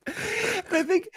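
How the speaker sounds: squeaky voice cracking with laughter; breathless, laughing high-pitched words. Real, not acted. Cracking up at the clip.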